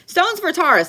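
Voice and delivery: big loud call: Stones for Taurus; loud announcement. Real, not acted. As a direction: blurt it out loudly. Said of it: "I'm sorry, Taurus".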